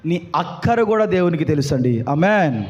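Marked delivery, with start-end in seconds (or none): none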